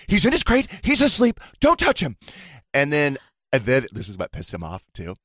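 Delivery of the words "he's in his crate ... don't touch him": higher, louder firm telling-off; stern scolding. Retold or imitated. Boyfriend scolding his delirious partner, high and stern. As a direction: shut the idea down firmly.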